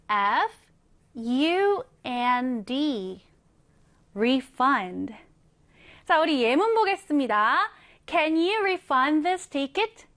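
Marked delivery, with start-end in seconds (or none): none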